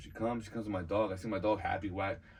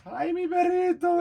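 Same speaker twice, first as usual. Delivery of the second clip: very high, loud mock wail; imitated crying mom; 10 dB louder, slower than usual. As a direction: wail like a distraught mother, hammed-up.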